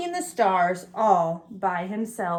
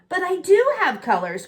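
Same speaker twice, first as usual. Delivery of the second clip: mildly raised plea, higher; cried; 7 semitones higher, 4 dB louder than usual. A narrator's reading. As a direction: protest earnestly, a touch louder.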